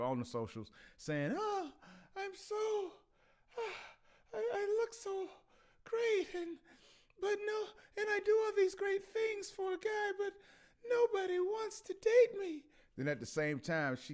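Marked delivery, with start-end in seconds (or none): 1.4-12.6 high-pitched, whimpering mock woman's voice; exaggerated falsetto poor-me lament; whining woman. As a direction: put on a tearful, self-pitying woman's whine. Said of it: "hear a woman saying".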